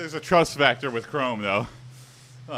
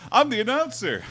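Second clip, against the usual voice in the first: wrestler promo shout; 8 semitones higher than usual. Retold or imitated. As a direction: threaten your opponent like a wrestler. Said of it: "Macho Man Randy Savage impression".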